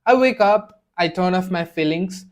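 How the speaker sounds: ordinary delivery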